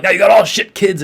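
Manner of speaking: retold yell at his mom, high and loud. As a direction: snap it at her like an angry dad. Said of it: "he's, like, yelling at his mom".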